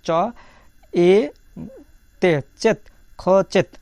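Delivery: "চেত, খ, চেত" built up in separate pieces; sounded out. Shown as a demonstration. Teacher showing learners how খোচিত is pronounced, sounded out piece by piece. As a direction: spell out চ, এ, তে, then join "চেত" firmly. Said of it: "খোচিতের উচ্চারণটা কেমন হবে?".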